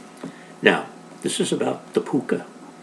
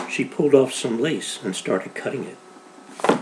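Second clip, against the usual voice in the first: plain reading voice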